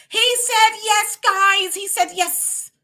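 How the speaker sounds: high laugh breaking through the words; real laughter. Real, not acted. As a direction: giggle through "He said yes". Laughing at the joke.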